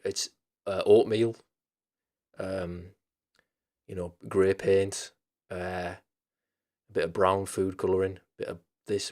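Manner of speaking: slow, understated deadpan list; imitating deadpan. Retelling Joe's answer to a reporter, mildly deadpan. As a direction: reel off each item slowly, with no fuss. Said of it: "he's so deadpan about it".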